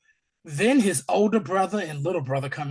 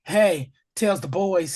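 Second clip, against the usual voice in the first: ordinary talk, nothing marked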